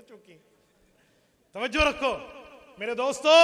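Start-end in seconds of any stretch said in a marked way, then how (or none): 1.5-3.4 loud, higher-pitched rallying call; preacher's ringing shout for attention. Real, not acted. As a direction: call out to rouse a tired crowd. Preacher calls the crowd to attention.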